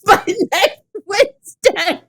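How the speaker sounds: high, loud, slow, broken by laughter; cracking up. Real, not acted. Host reading a silly listener comment, helpless with laughter. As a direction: barely get the words out for laughing.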